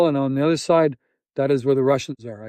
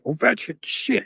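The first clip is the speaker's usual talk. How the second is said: a touch of imitated outrage, pitched up; retold mad captor; 7 semitones higher, 5 dB quieter than usual. Captor dismissing the player, high mock anger. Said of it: "he was mad".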